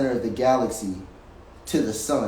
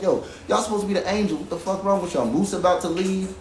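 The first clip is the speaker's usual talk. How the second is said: high, fast, heated complaint, retold; reliving his anger at the crew in a higher voice; angry rant at the group, high and fast; furious rant; 7 semitones higher than usual. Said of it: "I was furious".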